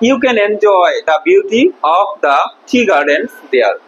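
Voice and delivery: slow, deliberate model sentence; slow reading. Shown as a demonstration. Teacher reading the textbook line slowly to the class. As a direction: spell it out slowly for the pupils. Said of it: "teacher reads sentence slowly".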